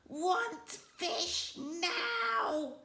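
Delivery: very high, very slow, strange dragon voice; hungry little dragon asking for fish. A narrator's reading. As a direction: stretch each word like a hungry baby dragon.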